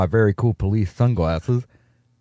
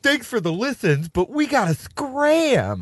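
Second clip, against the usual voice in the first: high, slow mock-frightened farewell; mock panic; 10 semitones higher, slower than usual. Podcaster fleeing the listening cops, high slow mock fright. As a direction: drag out a panicky sign-off.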